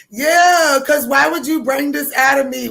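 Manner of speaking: high, loud aggrieved whine; hammed-up loud complaint; mock wailing protest. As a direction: wail it like a wronged party. Acting out crying fighter's protest.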